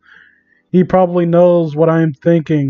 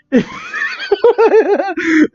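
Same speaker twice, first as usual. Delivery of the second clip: shrieking laugh; 13 semitones higher than usual. Real, not acted. Reacting to absurd fanfic.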